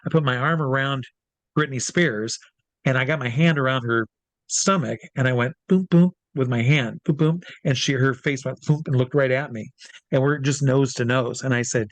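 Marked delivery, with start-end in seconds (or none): none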